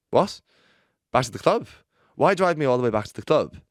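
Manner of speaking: loud, high indignant protest. Retold or imitated. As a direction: protest in disbelief. Outraged at taxi driver.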